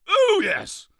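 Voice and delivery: a grand king greeting; pompous king. Acted. King voiced.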